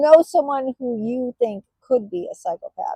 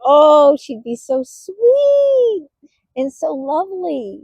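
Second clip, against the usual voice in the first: high, slow, sugary sweet voice; mock sweet mother; 5 semitones higher, 7 dB louder, slower than usual. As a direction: put on a fake-sweet mom voice. Mimics mother's sweet front.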